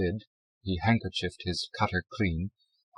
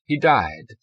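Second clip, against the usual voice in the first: put-on short, louder German-accented statement; 4 semitones higher, 7 dB louder than usual. A narrator's reading. Zell explains his father's absence, German accent. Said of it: "his German accent must have lingered".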